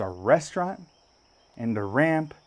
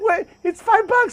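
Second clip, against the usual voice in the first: very high, loud insistent holler; Kevin; 5 dB louder than usual. Retold or imitated. Storyteller voicing his friend pushing the tractor, insistent. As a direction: imitate a pestering friend.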